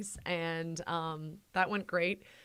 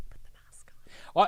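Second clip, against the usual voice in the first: mock whisper; faster than usual. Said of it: "She's like".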